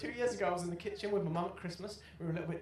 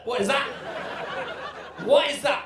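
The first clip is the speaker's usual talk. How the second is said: loud, higher shocked yell; shocked outburst; 5 semitones higher, 11 dB louder than usual. Retold or imitated. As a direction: break off in loud alarm. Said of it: "they're like".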